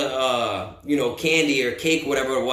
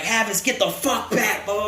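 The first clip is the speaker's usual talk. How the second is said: acted angry order; 5 semitones higher than usual. Acts out staff ordering him back.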